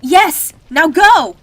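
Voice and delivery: very loud, high yell; desperate shout. Acted. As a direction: scream the order. Ordering escape from fire.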